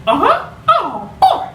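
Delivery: very high cartoon mouse chuckle; Mickey Mouse voice. Shown as a demonstration. Tutor demonstrating Mickey again. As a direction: bounce it up high. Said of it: "make a high voice too".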